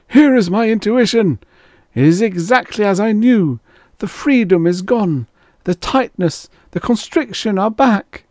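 high, urgent proclamation; crying out. A narrator's reading. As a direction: cry out the revelation, pitched high. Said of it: "Alpha cried".

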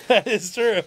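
real laugh breaking into the words; laughing agreement. Real, not acted. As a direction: say it while cracking up. Laughing at own remark.

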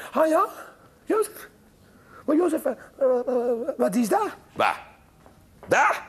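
high, startled woman. Acted. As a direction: ask like a puzzled young mother. Maria voiced high.

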